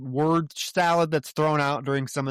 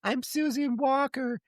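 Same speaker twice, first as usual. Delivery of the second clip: put-on much higher, slower, a small child's voice; little girl voice; 13 semitones higher than usual. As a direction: play the hypnotized woman as a ten-year-old girl. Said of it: "now she's talking like a little girl".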